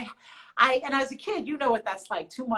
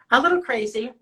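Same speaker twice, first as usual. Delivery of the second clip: louder, teasing repeat of little crazy; playful emphasis; 4 semitones higher, 7 dB louder than usual. Shown as a demonstration. Showing fans her nails, louder playful repeat. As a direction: lean into "little" with a playful rise. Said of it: "You wanna see my nail design?".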